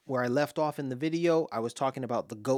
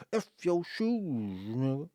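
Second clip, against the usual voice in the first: Dave Chappelle bit; 3 semitones higher, slower than usual. Retold or imitated. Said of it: "Dave Chappelle".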